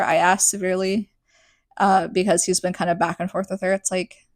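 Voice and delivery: usual speaking voice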